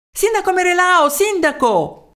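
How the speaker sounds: high, urgent calling out; shouted hail. A narrator's reading. Townsman runs up calling the mayor, high shout. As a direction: call the mayor from another alley. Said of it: "Cosa c'è da urlare così tanto".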